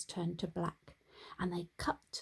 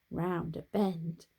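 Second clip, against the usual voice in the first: usual speaking voice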